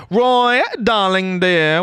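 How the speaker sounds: normal talking voice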